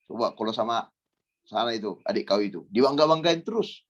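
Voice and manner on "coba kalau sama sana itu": usual speaking voice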